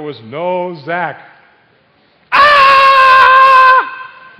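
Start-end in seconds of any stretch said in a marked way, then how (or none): 2.3-4.4 long, high, very loud scream; sustained full-volume shriek; mock scream. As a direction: scream out in comic alarm, holding one piercing note. Acting out the moment Zach is missing.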